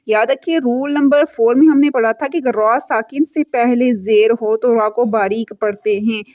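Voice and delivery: ordinary talk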